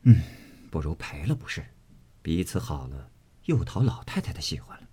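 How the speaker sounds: thinking to himself under his breath; inner thought. A narrator's reading. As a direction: Jia Lian weighing an apology in his mind. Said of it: "想着".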